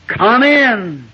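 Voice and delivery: lightly voiced old Kentucky host, called out high; Kentucky welcome call. Old man under the apple tree calling visitors in, high welcoming call. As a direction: sing out a hearty Kentucky come in. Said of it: "the old Kentucky expression".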